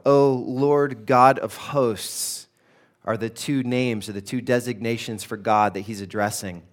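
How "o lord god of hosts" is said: slow, drawn-out reading of the name; solemn scripture reading. Shown as a demonstration. Pastor reading the psalm's address to God, slow and solemn. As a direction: intone it like a psalm being read aloud.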